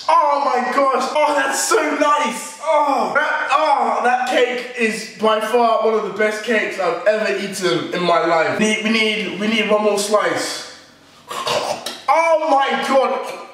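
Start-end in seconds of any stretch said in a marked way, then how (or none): 0.1-4.7 high, slow savouring moan of delight; hammed-up foodie bliss; delighted tasting. Real, not acted. Tasting the cake. 11.3-13.5 high, very slow drawn-out oh my God; hammed-up tasting rapture; blissful groan. Real, not acted. Tasting the cake.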